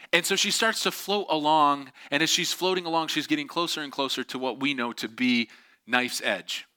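ordinary talk

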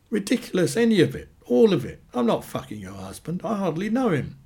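high, indignant protest; heated denial. A narrator's reading. Amanda to Annie, denying the affair, indignant.

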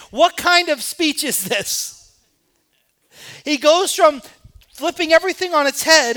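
loud, high, driving sermon climax; rising fervent preacher's cry; preaching at full force. Acted. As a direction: hammer the point home to the congregation. Preaching climax about worthless salt.

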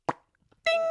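very high sung ding; mock spur ding. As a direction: ring it like a spur. Mock spur jingle sound.

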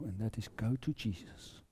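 a hushed preacher's whisper; soft whisper. Acted. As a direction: drop to a whisper on "go to Jesus".

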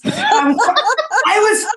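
real laughter breaking through the words; laughing. Real, not acted. Laughing while retelling crying.

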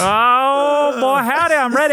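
high, loud hillbilly whoop; hammy Southern cowboy voice; country accent. Acted. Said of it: "in country accents".

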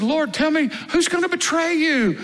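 high-pitched, insistent question; urgent pleading. Acted. Peter asking Jesus to name the betrayer, urgent pleading.

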